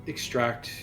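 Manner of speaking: normal talking voice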